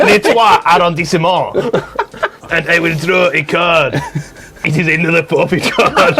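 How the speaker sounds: hammed-up French accent. Drawing another forfeit card under forfeit, mock French accent. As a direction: narrate your move as a comic Frenchman.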